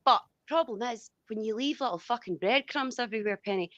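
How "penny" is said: ordinary talk, nothing marked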